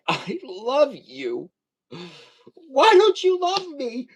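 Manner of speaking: hammed-up pleading to a partner; mock plea. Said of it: "I'd be like".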